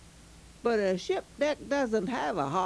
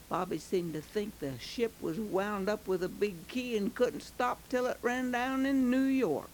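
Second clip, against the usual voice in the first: ordinary delivery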